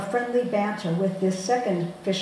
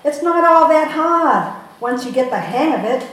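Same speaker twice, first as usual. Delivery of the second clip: put-on Boston accent; 6 semitones higher, 10 dB louder than usual. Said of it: "My fellow artless Bostonian says".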